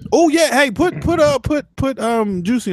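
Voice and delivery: high excited burst. Real, not acted. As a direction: blurt it out all excited. Excited sudden request.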